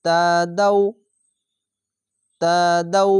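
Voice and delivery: তাদাউ with দাউ held one alif long before stopping; stretched ending. Shown as a demonstration. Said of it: "দাউ বলে এক আলিফ টেনে পড়বেন".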